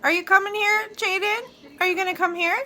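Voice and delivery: high, loud coaxing call; coaxing pet talk. Real, not acted. Owner coaxing a pet bird to come over, coaxing pet talk.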